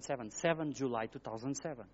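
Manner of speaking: ordinary delivery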